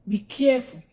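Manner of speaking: loud stern warning. Real, not acted. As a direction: warn her sternly. Warning repeated louder.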